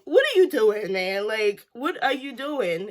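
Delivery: mock yelling at the TV; incredulous outburst. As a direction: call him out in disbelief. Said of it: "I was like".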